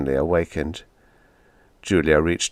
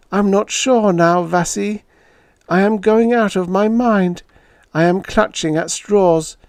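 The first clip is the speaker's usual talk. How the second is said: much higher, louder pleading woman's voice; woman calling out, close to panic; desperate Julia; 12 semitones higher, 5 dB louder than usual. Acted. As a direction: beg him to come back, frantic. Julia pleads, voiced high and loud.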